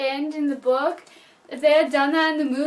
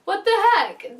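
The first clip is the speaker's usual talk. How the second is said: ordinary talk, nothing marked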